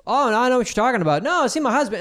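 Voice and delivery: high, rushed woman making excuses; mock flustered excuse. Caught woman explaining away the winning ticket, mock flustered.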